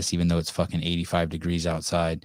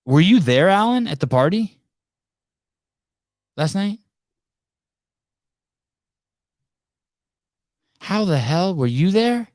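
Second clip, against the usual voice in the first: much higher, loud incredulous calling out; mock disbelief; 12 semitones higher, 7 dB louder than usual. Real, not acted. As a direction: pretend to be amazed he was there. Teasing host addressing Alan.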